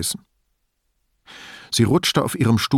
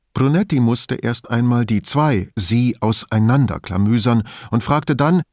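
plain reading voice

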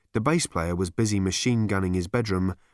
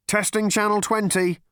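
third voice; 11 semitones higher, 3 dB louder than usual. Acted. Stage crew announcing the stunt ship, high and loud.

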